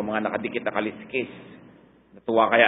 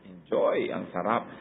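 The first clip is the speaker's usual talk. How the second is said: soft, lower delighted murmur; savoring food; 4 semitones lower, 6 dB quieter, slower than usual. Retold or imitated. Preacher showing how to praise food, softly delighted. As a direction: relish each bite quietly. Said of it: "how do you glorify God when you eat?".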